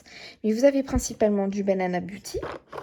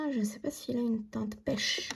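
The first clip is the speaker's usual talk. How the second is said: whisper; 9 dB quieter than usual. Real, not acted. Unsure aside while showing blush.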